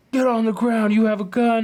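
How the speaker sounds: high, loud barked command; police shout. Retold or imitated. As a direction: bark it like a cop with gun drawn. Cops storming in, guns drawn.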